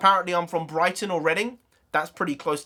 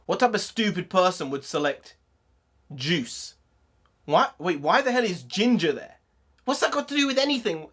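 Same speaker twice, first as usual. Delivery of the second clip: ordinary talk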